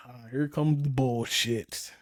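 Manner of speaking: slow, low resigned sigh of a line; weary dread. Retold or imitated. Husband seeing an argument coming, slow and resigned. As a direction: drag it out, bracing for trouble.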